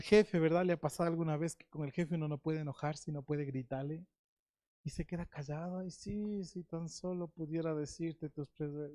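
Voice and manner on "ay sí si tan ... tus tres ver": soft, held-back grumble at the boss; quiet swallowed anger. Pretend. Employee holding back words to the boss, quietly. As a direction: keep it under your breath, cut yourself off.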